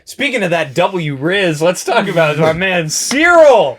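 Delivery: loud, high, slow hype intro; hype announcer. Acted. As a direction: build it up like a host. Hyped segue into topic.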